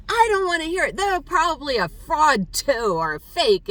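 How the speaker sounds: loud retold rant, high and strained; imitated screaming. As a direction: act out the hotel woman screaming at her. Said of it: "she was screaming at me".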